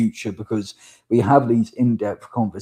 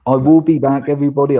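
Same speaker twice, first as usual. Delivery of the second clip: loud and high, calling out to the viewers; excited announcement; 5 semitones higher, 8 dB louder, faster than usual. Real, not acted. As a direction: announce your comeback with a burst of energy. Host announces comeback to viewers.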